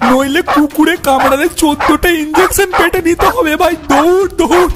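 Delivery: high, frantic warning to the others; panicked urging. Acted. Friends telling each other to flee the dog, panicked. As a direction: shout for everyone to run, panic building.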